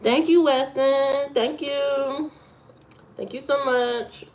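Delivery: high, bright thank you; grateful thanks. Real, not acted. Streamer thanking Weston, high and bright. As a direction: beam at Weston for the gift.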